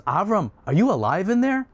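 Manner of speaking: high-pitched call rising into a question. Acted. Haran calling to his brother in the fire, calling out. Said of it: "stands up and he calls".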